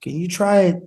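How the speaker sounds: lightly voiced understated director; quiet-storm director. Director nudging an actor between takes, quiet storm. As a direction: give a brief, understated note as the director. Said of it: "it's like the quiet storm".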